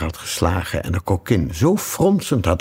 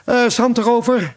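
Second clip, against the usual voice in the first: relieved king; 4 dB louder than usual. Acted.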